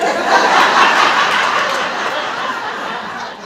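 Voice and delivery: big breathy burst of laughter; loud laugh. Real, not acted. Storyteller cracking up at her own fantasy retort.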